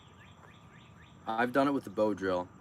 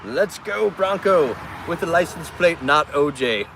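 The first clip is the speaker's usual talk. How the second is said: loud, high thrilled commentary; loud, high gleeful play-by-play; excited; 5 semitones higher, 5 dB louder than usual. Real, not acted. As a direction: cheer a driver's wave. Excited about engagement, Bronco.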